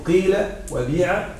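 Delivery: قيل وبيع with clear long ee; soft, low قيل وبيع, unrounded i; pure kasra. Shown as a demonstration. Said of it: "وتكسر الفاء كسرة خالصة".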